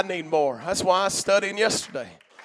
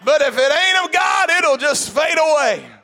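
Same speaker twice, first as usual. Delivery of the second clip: preaching at full force; 4 semitones higher than usual. Real, not acted. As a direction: preach it out over the congregation.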